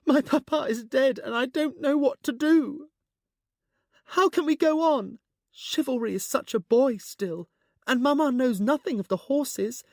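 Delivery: put-on high, loud, desperate young girl; grief-stricken. A narrator's reading. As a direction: pour out her grief, close to tears.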